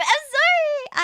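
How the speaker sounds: loud, very high drawn-out cartoon voice; Eric Cartman. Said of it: "Do you laugh like Cartman?".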